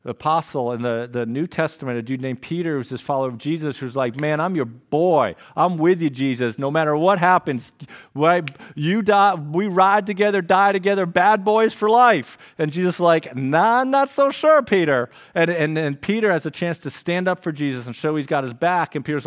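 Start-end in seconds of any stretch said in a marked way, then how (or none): 4.2-12.4 louder and higher, building to a shout; hyped Peter boast. Retold or imitated. Preacher acting out Peter bragging loyalty to Jesus, building shout. 13.3-15.1 high, louder skeptical "nah"; playful disbelief, pitched way up; Jesus doubting. Retold or imitated. Preacher voicing Jesus doubting Peter, high mock skeptic.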